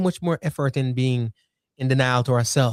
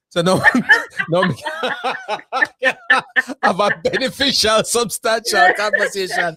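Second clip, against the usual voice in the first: words bubbling through real laughter, higher; laughing while talking; 7 semitones higher, 3 dB louder than usual. Real, not acted. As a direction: talk through the giggles. Laughing while telling a story.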